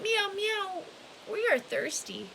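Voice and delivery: high mewing kitty voice; crying cats. Acted. Hundreds of cats crying to the old man, high mewing. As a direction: mew it like a thirsty kitten. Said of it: "cried the hundreds of cats".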